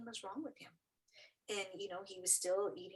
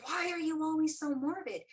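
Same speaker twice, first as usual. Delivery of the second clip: higher, louder retold protest; exasperated question, pitched up; mild exasperation; 8 semitones higher, 7 dB louder than usual. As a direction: retell it as an exasperated wife. Retold exasperated retort to husband.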